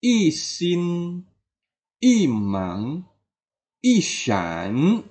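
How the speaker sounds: "i sin, i mang, i shan", each "i" falling; fourth-tone i. Shown as a demonstration. Teacher reciting examples, "i" falling in each pair. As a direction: drop every "i", then draw out the next syllable. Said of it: "i akan menggunakan nada keempat".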